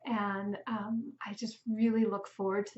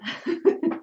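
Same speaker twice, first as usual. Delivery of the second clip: short breathy burst of laughter; quick amused laugh; 8 semitones higher, 8 dB louder than usual. Real, not acted. Amused at old class photos.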